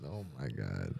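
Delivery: usual speaking voice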